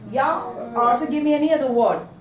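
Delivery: ordinary talk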